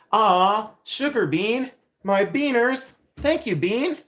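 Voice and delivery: high, delighted gush; high gushing thanks to a viewer. Real, not acted.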